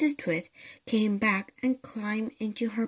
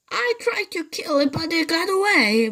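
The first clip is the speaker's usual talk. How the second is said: much higher, louder fake-frightened voice; mock alarm; 9 semitones higher, 4 dB louder than usual. Mr Twit pretending alarm to scare his wife. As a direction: ham up the danger.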